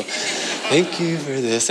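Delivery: slow, soft and soothing; mellow bedtime prayer. Retold or imitated. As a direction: pray gently to lull a child to sleep. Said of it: "really mellow prayers".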